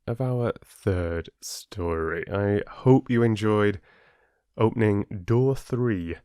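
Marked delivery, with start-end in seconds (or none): none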